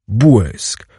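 ordinary delivery, nothing marked

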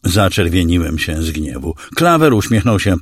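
ordinary delivery

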